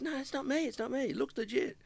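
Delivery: high-pitched quoted protest; acting out a high-voiced denial; imitated innocent denial. Mate denying a prank over the phone, imitated high protest.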